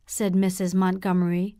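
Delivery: plain reading voice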